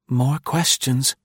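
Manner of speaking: slow, angry mutter under breath; tense muttering. Acted. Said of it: "she muttered angrily".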